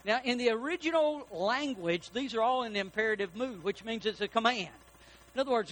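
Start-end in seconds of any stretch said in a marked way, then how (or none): none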